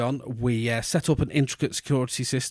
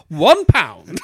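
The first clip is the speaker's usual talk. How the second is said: hammed-up shout; 4 semitones higher, 8 dB louder than usual. Posh kid shouting his order.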